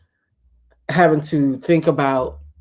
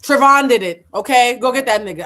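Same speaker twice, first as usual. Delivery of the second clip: raised, insistent call to name the shooter; loud indignant outburst; 7 semitones higher, 9 dB louder than usual. Real, not acted. Host reacting to the shooting story, demanding the shooter be named, loud and indignant. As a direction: push it out loud, fed up.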